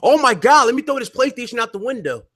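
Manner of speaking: high, drawn-out cries of overblown awe; mock fanboy hype. Podcaster mocking Xbox fans over console power, mock fanboy hype. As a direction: play a gushing fanboy losing it.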